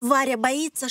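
plain reading voice

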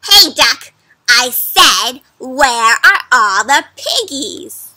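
loud, impatient shouting witch; loud witch demand. Acted. Witch yelling at a duck for the piggies, loud. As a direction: bark it at the dumb duck.

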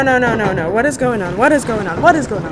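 low, soft, frightened murmur; scared whisper-quiet repeats; hushed dread. Real, not acted. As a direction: mutter it in growing dread. Scared, hushed under the sea.

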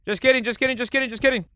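high, loud panicked chatter; panicked backpedal. Real, not acted. Player failing to jump over an enemy, panicked. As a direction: blurt it over and over in alarm.

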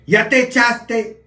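slangy Oaxaca voice. Retold or imitated. Oaxaca family sending him to bed, loud mock voice. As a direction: drag it out like a rowdy relative. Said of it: "Sino decían".

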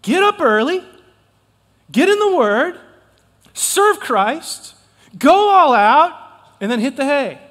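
high, loud rallying call; rousing shout. Real, not acted. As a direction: shout the routine like a chant. Rousing chant of the routine.

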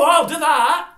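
loud, high mock-eager I'll do that; mocking eager yes. Vlogger mocking Paddy taking any show, hammed-up eager. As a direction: ham up a guy who grabs every gig.

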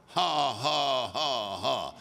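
deliberate laugh sinking low; forced laugh. Retold or imitated. Retelling forty-five minutes of laughing, forced laugh. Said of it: "So I just laughed for forty-five minutes".

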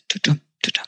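whispered thudding heartbeat; heartbeat sound. Acted. Pfiffi's racing heart, whispered tudum.

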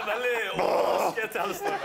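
high, loud words through laughter; laughing hard. Real, not acted. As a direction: crack up while getting the words out. Host cracks up at the impression. (ler høyt mens han snakker)

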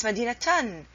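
مدينتان said "madinatan", falling on a sounded t-an; correct tanween with t. Shown as a demonstration. Teacher gives the correct form, مدينتان pronounced "madinatan". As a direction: finish مدينتان on a clear "tan".

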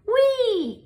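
high squealing wee; frog's squeal. A narrator's reading. Frog calls the others to dance, gleeful high squeal. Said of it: "Frog cried".

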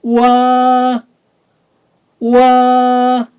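와 held loud on a high level note; high first tone. Shown as a demonstration. Teacher drilling vowels one by one, 와 high and level. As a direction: sustain 와 high, gliding through each vowel. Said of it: "하나하나씩".

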